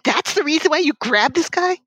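high, mock-bossy voice; mocking incredulous quote. Friends pushing the stranger at a roach, mock bossy. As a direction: scoff then wave the guy forward. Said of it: "And it's like".

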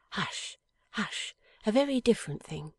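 lightly voiced young wife, tender and earnest; a hint of Lizzie. A narrator's reading. Young wife answering her dying husband, gently earnest.

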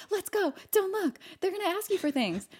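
high-pitched imitation of nervous friends; urging friends. Friends warning a girl to keep walking, high urgent imitation. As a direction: mimic friends urging her away, voice lifted high.

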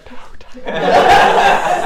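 whispered mortified plea; embarrassed daughter. Retold or imitated. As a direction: hiss it like an embarrassed kid. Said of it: "Poppy was looking at me like".

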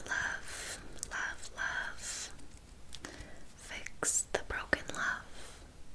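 slow, spaced-out whispered spell; whispered incantation. Acted. Witch casting a spell to reunite exes, whispered chant. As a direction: chant it in a whisper, pausing between phrases. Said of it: "perform the spell".

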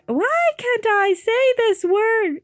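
very high, loud, drawn-out wail; exasperated wail. Real, not acted. Narrator stuck on a word, high exasperated wail. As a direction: wail it slowly at the top of your range.

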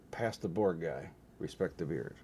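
normal talking voice

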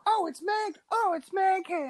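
ordinary talk

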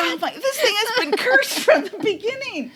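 high, laughing despair; laughing lament. Real, not acted. Host laughing that the episode is cursed. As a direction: wail it through laughter.

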